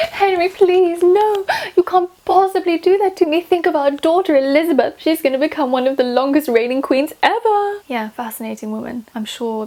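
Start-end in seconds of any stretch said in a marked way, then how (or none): 0.1-7.8 high, loud pleading queen; Anne Boleyn. Acted. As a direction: beg Henry desperately, hammed-up. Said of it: "Am I, am I her?".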